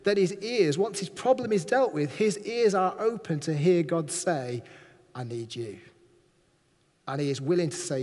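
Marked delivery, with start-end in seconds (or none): 5.2-5.8 much lower and softer, intimate; soft voice of God. Acted. God calling Isaiah, low and soft. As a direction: say it close, almost secret.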